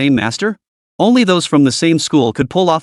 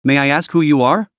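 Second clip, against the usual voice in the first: ordinary delivery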